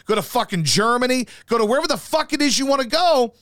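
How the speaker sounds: ordinary talk, nothing marked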